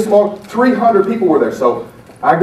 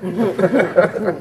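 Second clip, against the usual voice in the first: laugh; 3 semitones higher, 4 dB quieter than usual. Real, not acted. Laughing at his flub.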